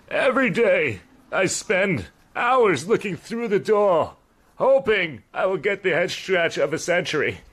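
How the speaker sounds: high, dramatic cat voice with swooping pitch; put-upon cat. Acted. Cat complaining to Archie about its owner, high hammed-up lament. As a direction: plead like a hammed-up neglected cat. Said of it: "He was just like".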